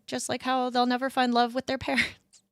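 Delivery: ordinary talk, nothing marked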